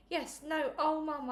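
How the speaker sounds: lightly voiced girl's wail of dismay. A narrator's reading. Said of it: "I wailed".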